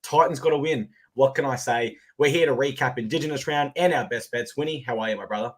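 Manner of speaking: ordinary talk, nothing marked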